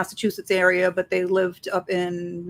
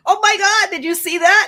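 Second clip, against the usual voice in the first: high, loud, gushing amazement; excited exclaim; 12 semitones higher, 6 dB louder than usual. Retold or imitated. Friend gushing to speaker about something they saw, loud excited exclaim. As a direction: squeal it like you can't believe what you saw.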